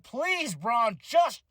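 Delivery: high, drawn-out begging; exasperated plea. Retold or imitated. Fan telling Braun to leave, high exasperated plea. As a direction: plead with him, worn out. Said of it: "I was like".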